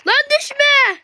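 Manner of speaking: a loud, high panicked shout at a friend; panicked yell. Real, not acted. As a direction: yell it in alarm. Yelling not to fall.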